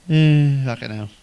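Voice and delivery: usual speaking voice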